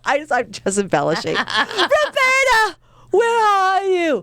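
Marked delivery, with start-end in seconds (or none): 1.3-4.2 high, very drawn-out theatrical calling; mock searching call. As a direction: call out slowly for someone lost, hammed up. Acts calling for lost recorder.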